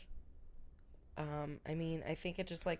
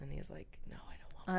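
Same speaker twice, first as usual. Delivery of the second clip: whispering; 4 semitones lower, 9 dB quieter than usual. Retold or imitated. Whispered retelling of Bruce.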